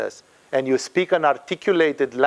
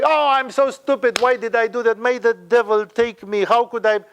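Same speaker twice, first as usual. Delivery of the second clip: higher-pitched mock lament at oneself; self-berating; 5 semitones higher than usual. Teacher imitating people who curse themselves, mock self-berating. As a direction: act out someone scolding themselves. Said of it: "And some people say".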